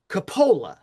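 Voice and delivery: Coppola with the stress on the middle syllable, co-POH-la; the way the New York family says Coppola; family pronunciation. Shown as a demonstration. Scene: explaining how his family said the name, stress on the middle syllable. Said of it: "would say our last name as".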